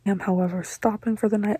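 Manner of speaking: ordinary talk, nothing marked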